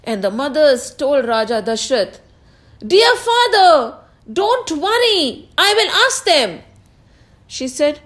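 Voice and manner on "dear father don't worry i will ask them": high, raised reassuring reply; answering the king. Acted. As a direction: call back dutifully in a high voice. Mother answers the calling king.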